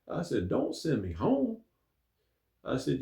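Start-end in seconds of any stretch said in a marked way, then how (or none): 0.5-1.6 hurt pleading. Retold or imitated. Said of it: "and I was hurt".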